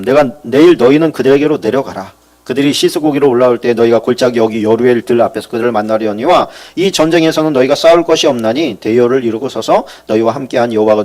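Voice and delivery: loud solemn reading of the verses; scripture reading. Acted. Preacher reading the battle verses aloud, solemn and loud. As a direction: proclaim the passage to the congregation.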